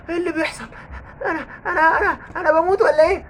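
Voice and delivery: high, panicked wailing cries; burning ghost screams. Acted. Ghost burning from the Quran, high panicked cries. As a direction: shriek as if burning alive.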